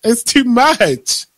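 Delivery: high-pitched exasperated protest; real worked-up frustration. Real, not acted. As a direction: argue back, fed up, slowing on the last complaint. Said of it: "but I get so mad".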